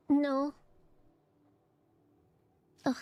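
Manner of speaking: usual speaking voice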